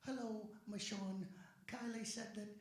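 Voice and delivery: high, gentle storybook-bear voice; Winnie the Pooh. Shown as a demonstration. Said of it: "in one of your voices, like Winnie the Pooh".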